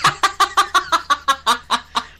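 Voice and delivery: high, loud real laughter; hearty high laugh. Real, not acted. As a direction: burst out laughing at the memory. Laughs remembering the neighbour.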